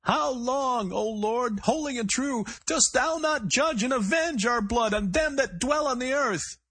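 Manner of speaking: shrill, high, slow crazed preacher's cry; high strained cry. A narrator's reading. Gunman crying scripture from inside the store, high and strained. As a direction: wail the psalm like a man at breaking point. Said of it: "the voice cried from inside the store".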